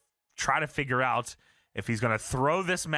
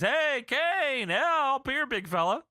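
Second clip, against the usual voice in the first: mock Edge taunt; 10 semitones higher, much slower than usual. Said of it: "Edge shows up on the Tron and says".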